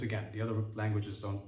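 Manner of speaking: ordinary talk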